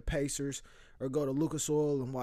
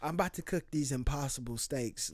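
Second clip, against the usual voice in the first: ordinary delivery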